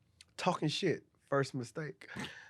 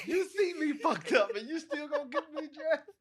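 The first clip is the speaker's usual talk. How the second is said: laughing disbelief; 9 semitones higher than usual. Retold or imitated. Retold inner thought, laughing.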